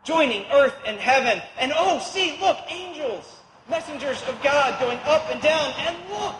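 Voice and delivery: overwhelmed dreamer pointing upward; breathless wonder. Acted. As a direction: gasp it out like seeing angels. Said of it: "breathlessly overpowering thing".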